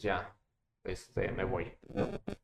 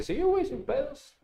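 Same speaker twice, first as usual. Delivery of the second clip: voicing a friend; 4 dB quieter than usual.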